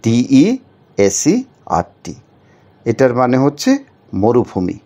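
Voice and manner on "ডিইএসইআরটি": ডিইএসইআরটি spelled very slowly in syllable chunks; slow syllable spelling. Shown as a demonstration. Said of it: "syllable ভাগ করে দিয়েছি".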